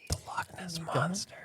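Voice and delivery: barely audible whisper. Real, not acted. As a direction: whisper the answer under your breath.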